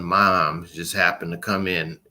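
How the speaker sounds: ordinary talk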